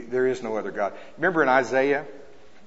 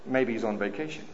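mocking; 3 semitones lower, 9 dB quieter than usual. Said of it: "he'd make fun of them".

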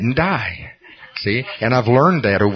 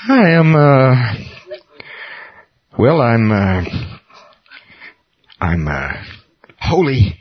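hesitant struggle; 2 semitones higher, 4 dB louder, slower than usual. Retold or imitated. Struggling to say "I am holy.

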